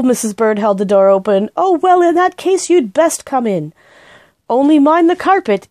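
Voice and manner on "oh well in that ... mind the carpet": high, louder bustling housekeeper; brisk housekeeper letting them in; fussy housekeeper. A narrator's reading. Housekeeper warning the bear about carpet, fussy.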